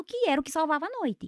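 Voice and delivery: usual speaking voice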